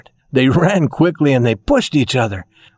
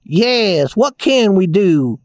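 slow toy soldier, slightly raised; 6 semitones higher, slower than usual. A narrator's reading. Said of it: "the tin soldier said".

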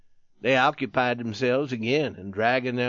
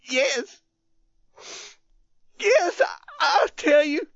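very high, loud, halting sobs; choked-up crying; 4 dB louder than usual. A narrator's reading. Youth promising his dying friend, choked and sobbing. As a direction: force the promise out through tears. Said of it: "He could not speak correctly".

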